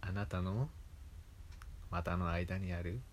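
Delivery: put-on slow soft ASMR girl; hypnosis voice. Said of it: "なんかその、".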